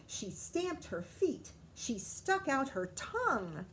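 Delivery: ordinary delivery, nothing marked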